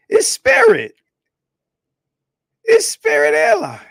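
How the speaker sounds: high, loud exclaim; loud shout. Real, not acted. Exclaiming loudly.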